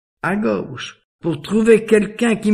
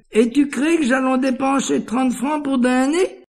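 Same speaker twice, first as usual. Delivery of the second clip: much higher, incredulous protest; mock indignation; 9 semitones higher than usual. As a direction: balk at the expense in a high-pitched question. Querelle jouée sur le prix. (indignation outrée, voix haut perchée)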